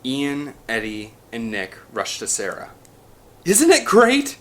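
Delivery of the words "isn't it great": high, loud thrilled cry; excited cry. A narrator's reading. Sarah thrilled to see the dinosaurs, high excited cry. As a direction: burst out with delight. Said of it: "she cried".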